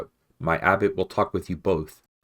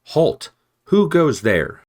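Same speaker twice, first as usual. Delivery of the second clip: sharp clear call; 10 semitones higher, 10 dB louder, slower than usual. Acted. Sentry Matthias challenging rats from the parapet, ringing shout. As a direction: ring out high and loud from the wall. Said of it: "voice rang out sharp and clear".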